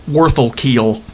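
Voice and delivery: ordinary delivery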